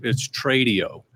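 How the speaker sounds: ordinary talk, nothing marked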